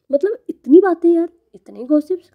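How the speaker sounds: high, loud exasperation; exasperated. Real, not acted. Exasperated at chatty passengers.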